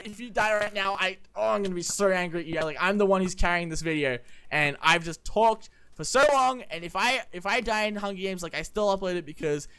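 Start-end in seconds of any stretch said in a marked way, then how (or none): none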